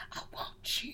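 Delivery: soft unvoiced whisper; creepy whisper. Little girl fixated on the general, whispering. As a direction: breathe it like a staring child. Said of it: "dead staring him like".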